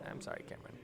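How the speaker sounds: soft unvoiced whisper. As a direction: whisper the apology. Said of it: "I was like".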